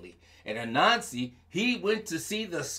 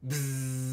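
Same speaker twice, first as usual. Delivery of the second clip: soft buzzing bee sound; 4 semitones lower, 4 dB quieter than usual. Acted. Storyteller imitating the bee, a soft buzz.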